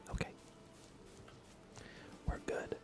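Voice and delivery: ordinary delivery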